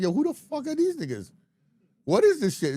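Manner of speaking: high, loud, amazed outburst; mock-stunned, pitch shooting up; re-enacted astonishment. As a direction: replay being floored on first listen. Acting out first hearing the album.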